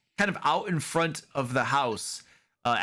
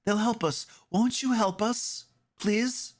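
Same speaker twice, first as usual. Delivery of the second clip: put-on pleading little girl; 9 semitones higher than usual. Girl begs the party.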